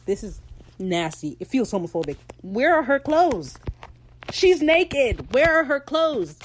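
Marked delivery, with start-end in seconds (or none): 2.4-6.4 higher, louder indignant demand; outraged. Real, not acted. Fan ranting about Steed's costume, outraged and louder. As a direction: demand it in disbelief. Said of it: "outraged rant on costume".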